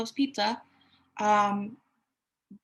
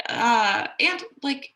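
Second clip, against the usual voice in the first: usual speaking voice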